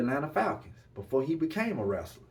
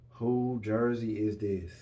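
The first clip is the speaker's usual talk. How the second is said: slow, drawn-out teasing question; slowly dragged teasing ask; slow tease; 2 semitones lower, slower than usual. Real, not acted. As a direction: stretch it out to build suspense.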